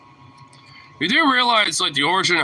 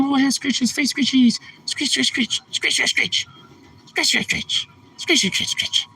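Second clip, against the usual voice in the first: high singsong pet voice; 12 semitones higher, slower than usual. Real, not acted. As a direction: coo while scratching him. Baby talk while scratching dog.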